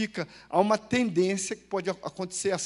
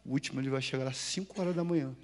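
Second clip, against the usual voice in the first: much softer, lower, wry muttered aside; private thought aside; 7 semitones lower, 9 dB quieter than usual. Retold or imitated. Preacher shares his inner thought with the congregation, softer and lower. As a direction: drop the voice to a private joke. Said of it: "eu pensei assim".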